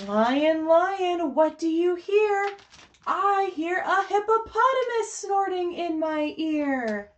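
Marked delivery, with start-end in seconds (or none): none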